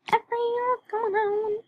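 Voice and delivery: high, slow singsong welcome; put-on cheery greeting. Voicing a welcome message for character.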